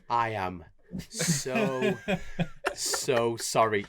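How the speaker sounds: halting, drawn out on "so, so sorry"; mortified apology. Retold or imitated. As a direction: pause before each "so" and stretch the apology. Retelling his mortified apology.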